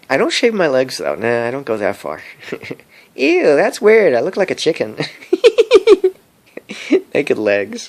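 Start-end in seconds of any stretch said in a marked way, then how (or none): 3.2-5.0 high, loud squeal breaking into a laugh; mock disgust. Vlogger squeals at his chicken-like legs, mock disgust. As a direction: act grossed out, laughing.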